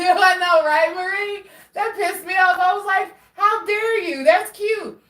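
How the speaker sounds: high, loud, laughing mock outrage; excited laughing rant. Real, not acted. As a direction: squeal it, laughing at yourself. Laughing about the iPhone emoji.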